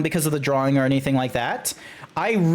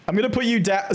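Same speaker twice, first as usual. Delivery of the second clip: usual speaking voice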